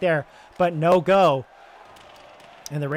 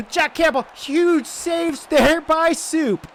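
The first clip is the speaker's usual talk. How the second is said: much higher and louder, stretching huge saves slowly; excited roar; 11 semitones higher, 4 dB louder, much slower than usual. Real, not acted. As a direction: scream the save out in real excitement. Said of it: "I'm getting so excited about this".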